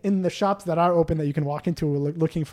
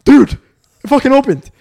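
amazed; 9 semitones higher, 10 dB louder than usual. Retold or imitated. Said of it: "And I'm like".

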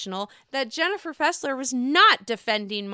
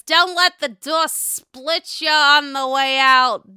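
loud, slow mock send-off; Jennifer Aydin; 3 semitones higher, 8 dB louder, slower than usual. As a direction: imitate her shouting someone out, drawn out. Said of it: "Jennifer says".

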